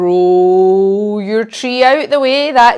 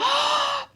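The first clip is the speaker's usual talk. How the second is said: fed up horse blow; 7 dB quieter than usual. Shown as a demonstration. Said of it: "and we go".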